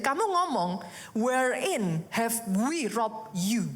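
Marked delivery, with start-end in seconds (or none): 1.1-3.7 slow reading. Shown as a demonstration. Reading the verse in English.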